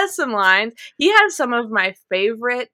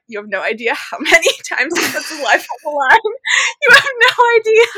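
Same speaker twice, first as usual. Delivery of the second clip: exasperated outburst; 6 semitones higher than usual. Retold or imitated. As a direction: vent at the book, half laughing. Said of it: "I'm like".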